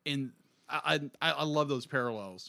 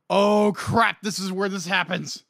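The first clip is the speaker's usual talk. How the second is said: alarmed shout; 6 semitones higher, 10 dB louder than usual. Retold or imitated. Alarmed reaction to scene.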